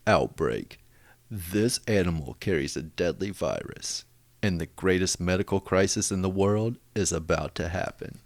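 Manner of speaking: deep dramatic trailer narration; movie trailer voice. Shown as a demonstration. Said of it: "you have to do the movie voice".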